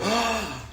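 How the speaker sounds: high, soft imitated gasp of shock. Friends seeing the crash on the pole, a mock shocked gasp. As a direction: suck in air at the sight.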